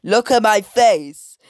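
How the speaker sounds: loud character shout; imitating a shout. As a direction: shout at the girls as Miss Hattie. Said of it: "Miss Hattie shouted at the girls".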